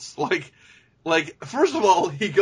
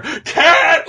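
sudden high, loud shout of a name; imitated scream; 13 semitones higher, 9 dB louder than usual. Imitating a father interrupting himself to scream his daughter's name, sudden shriek. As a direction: scream it out as if cutting yourself off. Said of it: "interrupts his own sentence to scream".

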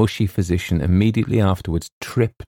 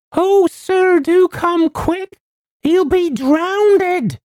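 very high, loud, slow wail; panicked housekeeper; 6 dB louder, much slower than usual. A narrator's reading. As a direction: wail the plea long and piercing. Panicked housekeeper begs for help.

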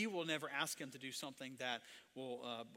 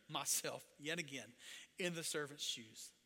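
ordinary talk

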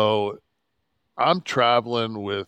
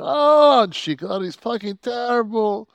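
mocking falsetto; 13 semitones higher, 8 dB louder than usual. Booker mocking bad promo.

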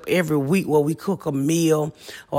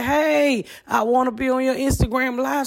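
mock eager fan; 7 semitones higher than usual. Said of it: "You wave your hand and say".

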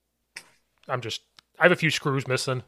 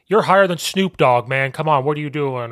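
loud, higher coaching jab; coach's holler; 3 semitones higher, 8 dB louder than usual. Retold or imitated. Coach retelling a jab at a high-blocking lineman, hollering. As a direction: call it out across the field, exasperated.

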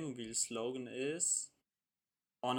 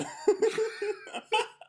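high laugh; 8 dB louder than usual. Real, not acted. Laughs at the connection trouble.

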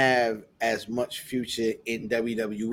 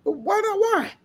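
incredulous; 11 semitones higher than usual. Real, not acted. Protesting in debate.

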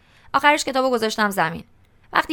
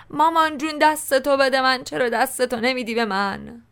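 mock-pleading to mama, pitched up; clowning; 4 semitones higher than usual. A narrator's reading. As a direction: play-act a blind child begging for a hand. (التماس مسخره و نمایشی)

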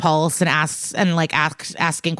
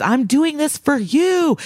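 earnest plea; 8 semitones higher than usual. Retold or imitated. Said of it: "And he responds".

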